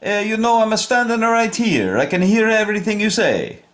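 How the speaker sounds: high hammed-up Italian accent; high comic Italian voice. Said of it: "because of my accent".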